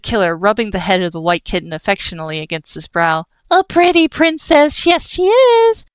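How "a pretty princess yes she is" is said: very high, slow sing-song coo; baby talk. Acted. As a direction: coo at the kitten like a princess. Cooing to a kitten.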